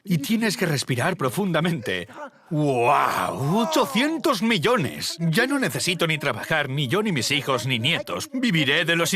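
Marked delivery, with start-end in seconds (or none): 2.5-3.6 amazed wow. Retold or imitated. Reaction to money. 3.6-5.2 slow, much higher, in wonder; awestruck. Retold or imitated. Realising the fortune is real, slow awestruck wonder. Reaction to money.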